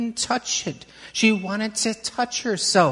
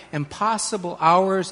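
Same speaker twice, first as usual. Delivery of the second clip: usual speaking voice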